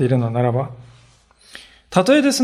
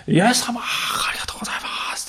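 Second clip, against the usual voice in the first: whispered, humble thanks; reverent whisper; 7 semitones higher, 7 dB quieter than usual. Acted. Samaritan whispering his grateful thanks to Jesus. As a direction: breathe the thanks softly, bowed before him.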